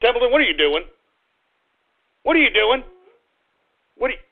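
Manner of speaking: ordinary talk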